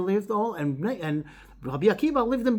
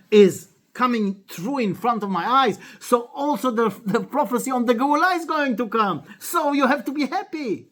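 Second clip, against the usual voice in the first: building higher and louder to a triumphant point; retold excited conviction; 6 semitones higher, 5 dB louder than usual. Rabbi Akiva answers the crying rabbis, retold excited conviction. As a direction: drive the good news home with rising force.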